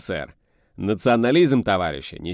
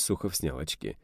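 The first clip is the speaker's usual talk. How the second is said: plain reading voice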